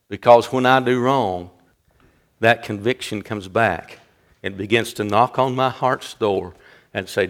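low, soft, weighty sermon delivery; hushed preaching. Real, not acted. Preacher marvelling at Jesus before the congregation, hushed preaching. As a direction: drop right down and let it sink in.

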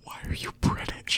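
urgent whisper off the phone. Real, not acted. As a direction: whisper it so the caller can't hear. Aside whispered during phone call.

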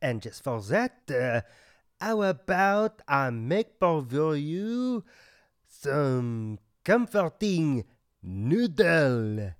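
hammed-up French waiter, rising eh on every line; thick mock French accent, eh after each line; comic French accent. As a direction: play the offended French waiter.

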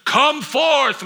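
called out high and strong; commanding shout to the tomb; loud command. Acted. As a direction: summon the dead man from the tomb. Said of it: "he says with a loud voice".

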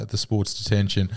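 ordinary delivery

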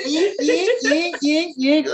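high, repeated shrieking string stabs; mock horror-film stabbing noise; Psycho stab screech. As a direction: imitate screeching horror violins in even jabs. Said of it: "like in the movie Psycho".

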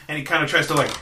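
normal talking voice

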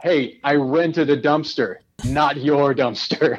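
slow, pointed delivery; measured, drawn-out disclaimer; paranoid clarification. Shown as a demonstration. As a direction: spell it out slowly, warning others off. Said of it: "I say it with more of like".

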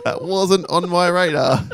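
high, very slow mock surprise; mock astonishment. Host mimicking a moviegoer caught unaware, mock astonishment. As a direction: draw it out in hammed-up disbelief. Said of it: "Exactly the way everyone thinks".

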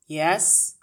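loud, curt answer at the door; a loud, curt challenge to a caller. A narrator's reading. Ratty man answers Miss Honey at the door, curt.

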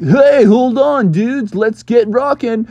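high, loud, drawn-out cartoon pilot; rally the passengers for takeoff; Launchpad. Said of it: "Launchpad was driving".

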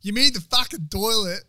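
loud, high, drawn-out mock-Aussie voice; country Aussie local. Local corrects a stranger seeking a bathroom, loud mock country Aussie. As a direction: impersonate a brash rural Aussie. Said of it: "I was in country Australia".

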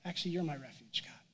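hushed unvoiced whisper; the Spirit whispering gentle truth to David. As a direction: breathe it softly as a secret comfort. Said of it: "the Spirit just whispers".

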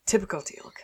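unvoiced, dry whisper of weary scorn; whispered aside. A fan whispering about Teal'c, dry and unimpressed. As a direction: breathe it out like an eye-rolling aside. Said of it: "I was like".

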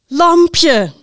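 loud, high proclamation with a falling end; winner announcement. A narrator's reading. Host names the number one book, loud announcement.

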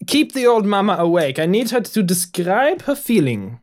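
put-on high, insistent German accent; German doctor. German doctor instructing the sheriff, high German accent. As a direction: order it like a fussy German physician. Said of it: "The German doctor".

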